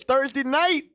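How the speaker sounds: host hyping the show schedule; announcer plug. Acted. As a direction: hype it like a promo. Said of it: "host announcing show lineup".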